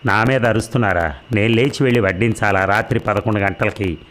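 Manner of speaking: ordinary delivery, nothing marked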